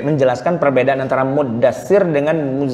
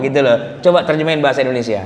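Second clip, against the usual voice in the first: normal talking voice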